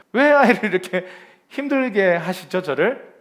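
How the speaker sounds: play-acted aggrieved outburst; mock complaint. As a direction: wail it in comic exasperation. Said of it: "이렇게 우리는 불평한다는 거예요".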